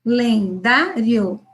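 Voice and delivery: calendário drawn out slowly, syllable by syllable; syllable-by-syllable reading. Shown as a demonstration. Teacher showing a child sounding out calendário, slow. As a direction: stretch calendário slowly, one syllable at a time. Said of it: "ele vai lá e ele usa, ó".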